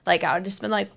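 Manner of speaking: ordinary delivery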